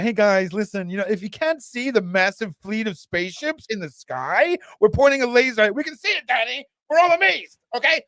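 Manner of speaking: high, breezy mocking voice that climbs as it goes; mock nonchalant Danny. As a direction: send up a casual believer pointing at the sky. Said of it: "Danny's so nonchalant about it".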